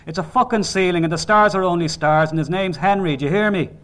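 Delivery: furious father laying down facts; angry shouting. A narrator's reading. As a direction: shout it through without letting up. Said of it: "adding to his anger".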